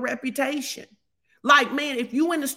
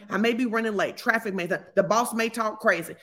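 usual speaking voice

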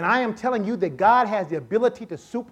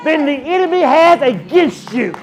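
louder, higher, driving shout; full-force preaching; 5 semitones higher, 9 dB louder than usual. Acted. Preacher to congregation at the climax, shouting. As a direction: preach it to the rafters.

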